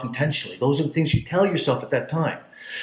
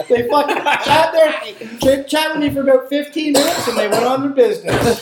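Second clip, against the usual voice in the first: laughing narration; 13 semitones higher, 6 dB louder than usual. Real, not acted. Finishing the biker story, high and laughing. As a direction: talk through the last giggles.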